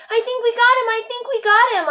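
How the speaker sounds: high excited yell. A narrator's reading. Boy yelling to his friend over the caught lizard, excited. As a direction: shout it, thrilled at the catch. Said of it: "I yelled".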